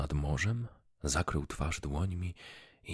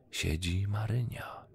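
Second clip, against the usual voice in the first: ordinary delivery, nothing marked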